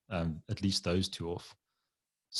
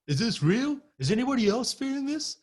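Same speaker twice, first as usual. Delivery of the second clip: very high mock-panicked voice; frightened mimicry, pitch way up; imitating scared man. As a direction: plead it as the freaked-out guy. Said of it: "he was sounding scared and".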